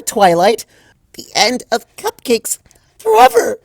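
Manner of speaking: quick, high, building to a shriek; Pinkie doom rant. Acted. Pinkie ranting to Twilight, building to a high shriek. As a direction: race through the apocalypse to a wail.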